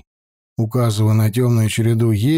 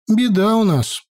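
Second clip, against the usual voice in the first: higher village woman blurting; blurted out; 10 semitones higher than usual. A narrator's reading. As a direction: blurt it out bluntly. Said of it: "без предисловий выпалила молотка".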